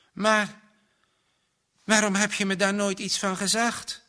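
high-pitched, pained question; hurt. A narrator's reading. Johannes learns his friend kept it from him, hurt. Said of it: "Even vlamde er een pijn door Johannes".

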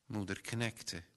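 ordinary delivery, nothing marked

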